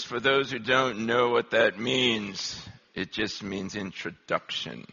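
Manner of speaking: put-on slow, low, soft English accent; subdued English accent, pitched low. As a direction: explain it quietly like an English lecturer. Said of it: "he had an English accent".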